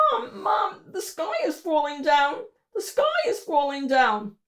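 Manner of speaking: put-on high excited child voice; a small boy crying out about the sky; little Mo calling Mom. Little Mo calling out to his mom, high and excited.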